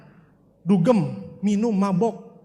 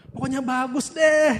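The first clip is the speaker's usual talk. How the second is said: higher, slow, sing-song dodge; coy evasive dodge; 6 semitones higher, slower than usual. Retold or imitated. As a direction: dodge the question with a higher, drawn-out voice. Evasive friend dodging MLM question. (menghindar dengan nada tinggi, lambat, manja)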